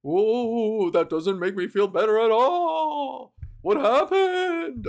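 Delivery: ghostly voice, loud, slow at the end; spooky ghost voice. Pretend. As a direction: play the dismayed ghost, stretching it out. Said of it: "and they're like".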